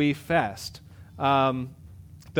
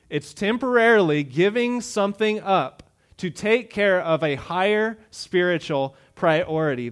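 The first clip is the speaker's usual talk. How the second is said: louder, emphatic preaching; 3 semitones higher, 4 dB louder than usual. Acted. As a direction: drive the point home from the pulpit. Preacher drives home definition.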